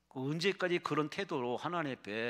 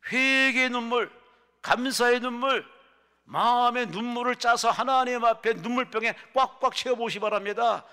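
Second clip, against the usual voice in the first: shouted exhortation; 10 semitones higher, 13 dB louder than usual. Acted. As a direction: belt out each phrase as a rallying cry. Exhorts congregation at full force. (길게 끌며 크게 부르짖는 설교)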